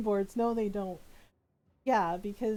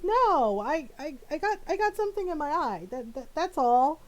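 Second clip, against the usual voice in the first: loud, high, stammering mock denial; flustered denial; 7 semitones higher, 5 dB louder than usual. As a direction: imitate a husband protesting too much, pitched up. Husband denying he is crying, retold.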